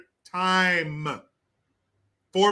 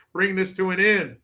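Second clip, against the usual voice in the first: normal talking voice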